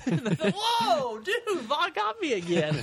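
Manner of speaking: high, slow, laughing through the words; laughing mock reply. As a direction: play the friend cracking up at the prank.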